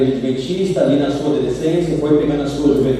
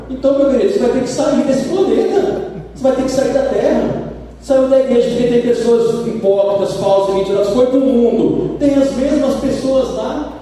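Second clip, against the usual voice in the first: higher-pitched, driving pulpit voice; fervent preaching; 8 semitones higher than usual. Acted. Preacher pressing the lesson home to the congregation, fervent.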